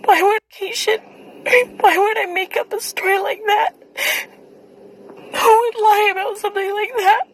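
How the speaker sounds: high, tearful pleading; crying. Real, not acted. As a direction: voice breaking through tears. Breaks down crying over accusations.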